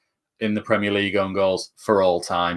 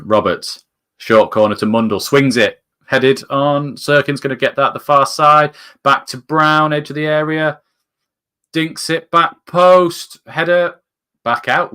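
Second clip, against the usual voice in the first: louder, higher corner commentary that climbs to the header; live play-by-play; 6 semitones higher, 7 dB louder, slower than usual. Acted. Fan calling a Sunderland corner live, building excitement. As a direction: call the corner routine as it unfolds, rising.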